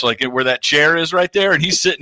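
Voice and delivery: ordinary talk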